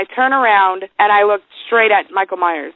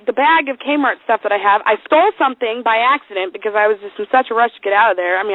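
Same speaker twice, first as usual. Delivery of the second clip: ordinary talk, nothing marked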